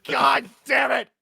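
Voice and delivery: loud, slow exasperated curse; frustrated shout. Real, not acted. Player exasperated at NPC.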